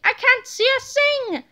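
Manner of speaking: high, complaining cry; high-pitched cry. A narrator's reading. Tiny Tippy unable to see, crying out high. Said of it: "cried Tiny Tippy".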